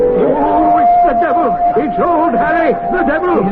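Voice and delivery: high, urgent exclamations; excited shouting. Acted. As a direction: cry out as if seeing the devil himself. Seeing the devil in fireplace.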